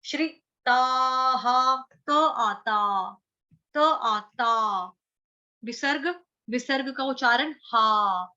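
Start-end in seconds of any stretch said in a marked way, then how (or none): 0.0-4.9 each syllable drawn out slowly; slow teaching chant. Shown as a demonstration. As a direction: sound it out very slowly. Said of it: "Shri".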